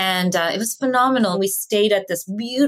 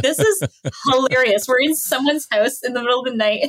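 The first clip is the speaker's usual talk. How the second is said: laughing; 4 semitones higher than usual. Retold or imitated. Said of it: "laughing my head off".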